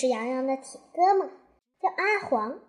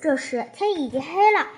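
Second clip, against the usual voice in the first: ordinary delivery, nothing marked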